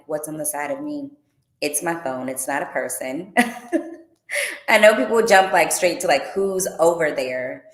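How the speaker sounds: normal talking voice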